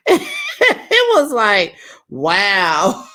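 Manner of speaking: slow, high laughing "wow". Real, not acted. Host laughing at the episode's ending, high laugh. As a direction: laugh through the words.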